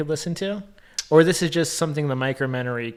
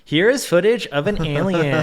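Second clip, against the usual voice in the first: mock documentary narrator, stiffly enunciated; stilted script reading; 3 semitones higher, 4 dB louder than usual. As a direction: parody a poorly read alien-footage script. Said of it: "always talking like this, like, as if they are reading from a script".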